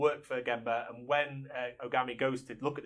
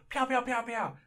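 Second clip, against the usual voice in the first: high, bright pow pow gunshot noises; mock gunshots; 10 semitones higher, slower than usual. Retelling the gunman's shooting practice, high mock gunshots. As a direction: fire off high cartoon gun sounds.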